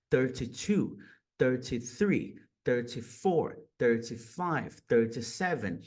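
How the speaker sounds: each THIRTY with a crisp T; British-style T in THIRTY; British thirty numbers. Shown as a demonstration. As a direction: count carefully, sounding the T in THIRTY. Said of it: "eles vão pronunciar esse T".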